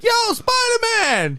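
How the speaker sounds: high, drawn-out holler; imitated calling out. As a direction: hail a superhero from the street. Acting out film bystander calling out.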